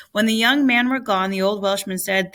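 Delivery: ordinary delivery, nothing marked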